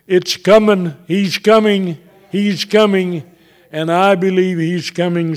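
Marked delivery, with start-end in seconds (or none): 0.1-3.2 higher, ringing repeated declarations; fervent proclamation. Real, not acted. Preacher announcing Christ's return, fervent proclamation. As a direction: proclaim it with rising conviction.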